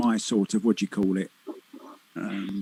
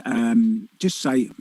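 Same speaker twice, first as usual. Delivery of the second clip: ordinary talk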